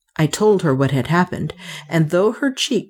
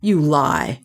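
angry accusation. A narrator's reading. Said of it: "he cried".

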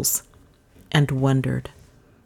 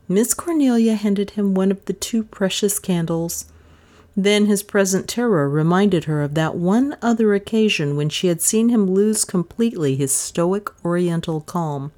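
ordinary delivery, nothing marked

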